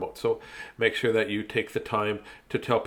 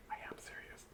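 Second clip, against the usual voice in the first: whisper; 25 dB quieter than usual. Real, not acted. Whispered aside after joke.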